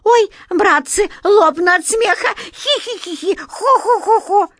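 very high, loud, lifeless hee-hee, ho-ho; pitiful imitation of hysterical laughing; forced fake laughter. A narrator's reading. Old actor straining to seem amused, forced laughter. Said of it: "это был не смех, а лишь жалкое мёртвое его подобие".

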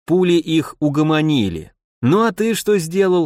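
ordinary delivery

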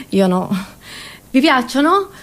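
ordinary delivery

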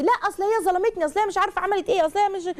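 a daughter pouring out her complaint, high and whiny; whiny upset daughter. Retold or imitated. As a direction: rush the grievance up high like a wronged teen.

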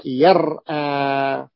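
يرأى with a still r, then a glottal stop and long ā; original full form. Shown as a demonstration. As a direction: say يرأى as yar-ā, hamza sounded. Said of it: "من المفترض أن نقول".

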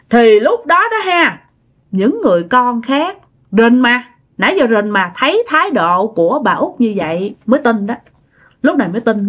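ordinary delivery

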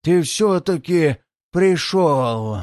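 slow wheezing demon voice; creeping nightmare whisper. Acted. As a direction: drag it out like a waking monster. The waking demon speaks.

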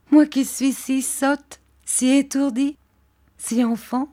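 playfully running herself down; Dora's voice. Acted. Dora mocks herself. (voix enfantine, plus aiguë, lente)

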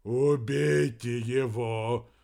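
loud, slow, drawn-out moan. A narrator's reading. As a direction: groan it out in suffering, stretched. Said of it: "вновь простонал Вернон".

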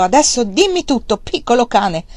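angry shout. A narrator's reading. Father shaking his younger son, shouting angrily. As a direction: shake the truth out of him. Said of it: "lo scosse e gridò".

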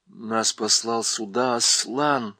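plain reading voice

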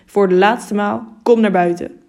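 loud, forceful ultimatum; angry. A narrator's reading. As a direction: order them out for the last time. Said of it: "riep de burgemeester weer boos".

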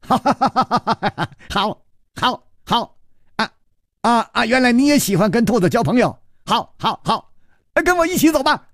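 high, giddy laughing talk; feigned madman laughs and invites Chu along; laughing madly. Acted. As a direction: giggle through it like a lunatic. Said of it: "反而大笑".

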